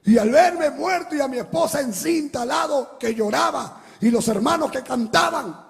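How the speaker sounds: loud, high preaching with the music; fiery preaching. Acted. Preacher pouring out his vision of death to the church. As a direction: build the vision to a cry.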